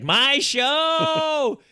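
very high, loud whining shriek; child's shriek. Retold or imitated. Dad imitating his daughter shrieking for her show. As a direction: shriek it like a frustrated toddler. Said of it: "she's like".

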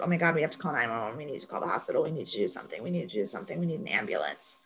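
mock panic, rushed and hushed under the breath; freaking out. Recapping how a TV character begs for an ambulance, quietly frantic. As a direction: rattle it off fast and low. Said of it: "Annie is freaking out".

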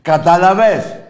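loud, high rant at callers; furious radio host yelling; shouting. Real, not acted. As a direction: bellow it at the callers.

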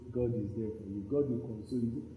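low, soft reassurance; acted consoling. Comforter telling a mourner God is there, soft low consoling. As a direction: comfort them softly and low.

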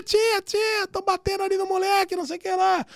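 very high mock child's voice; squeaky, urgent kid calling for auntie; little kid raising alarm. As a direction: imitate a child running to tattle. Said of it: "o criancinha sai correndo".

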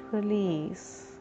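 ordinary delivery, nothing marked

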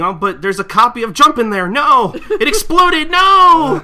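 high, loud outraged wail, laughing; complaining customer. Retold or imitated. Customer complaining about his soaked magazine, loud high wail. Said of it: "he just keeps complaining".

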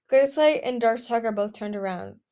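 ordinary delivery

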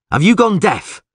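loud, high, indignant demand; angry shout. Acted. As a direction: snap it at people ignoring you. Angry at prisoners not replying.

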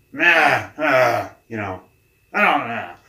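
mock sleepy groan, loud and high; retold sleepy protest; groggy grumble. Imitating groggy Mike.

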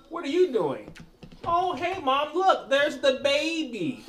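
put-on squeaky little kid pointing things out; high kid voice. Imitating niece chatter.

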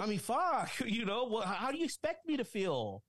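high, exasperated angry rant; pissed off. Real, not acted. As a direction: let the frustration spill out. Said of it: "I'm pissed off".

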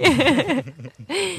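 high burst of loud laughter; loud cackling laugh. Real, not acted.

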